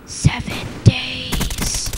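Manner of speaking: very soft, slow "Seven days", each word dragged out; eerie ghost-on-the-phone voice; creepy slow murmur. Acted.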